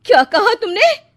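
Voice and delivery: loud, high, stung outburst; outraged. Acted. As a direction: snap back in disbelief. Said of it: "आशा ये सुनकर तिलमिला उठती है".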